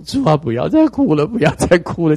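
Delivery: exhausted plea. Retold or imitated. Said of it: "肝肠寸断".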